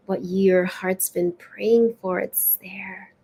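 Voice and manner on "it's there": tender whispered reassurance; hushed whisper of comfort. Real, not acted. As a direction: breathe it softly like a secret. Closing reassurance of card reading.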